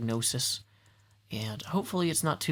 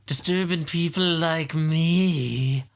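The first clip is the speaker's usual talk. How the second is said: Walt Brisby voice; 4 dB louder, much slower than usual. Pretend. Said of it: "That accent is, like, from the Walt Brisby voice".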